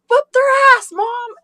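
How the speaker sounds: high, loud shouted cheer; yelling cheer. Retold or imitated. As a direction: yell it out as an eager kid. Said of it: "And I'm like".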